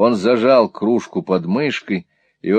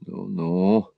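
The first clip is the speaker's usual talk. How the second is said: low, softened, bemused murmur; puzzled mutter; 4 semitones lower, 5 dB quieter than usual. A narrator's reading. Newman reacts to Smike's tears, muttering in some bewilderment. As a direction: mumble it softly, as if at a loss. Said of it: "пробормотал Ньюман, как бы с некоторым недоумением".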